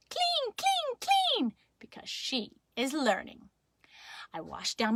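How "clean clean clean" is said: put-on very high little-kid squeak; toddler voice. Little sister learning words, high toddler pipe. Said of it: "and Maggers said".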